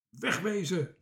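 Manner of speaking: shouted order. A narrator's reading. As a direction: call out like a sailor clearing people away. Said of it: "schreeuwde de matroos".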